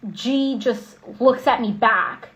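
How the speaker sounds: normal talking voice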